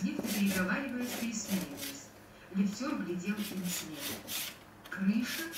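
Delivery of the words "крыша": «крыша» with rising question tune. A narrator's reading. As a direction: ask «крыша» uncertainly. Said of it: "произнёс он вопросительно". (вопросительно)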